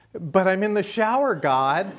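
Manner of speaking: high, loud startled protest. Retold or imitated. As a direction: squeak it out, caught off guard in the shower. Roger protesting to God, retold.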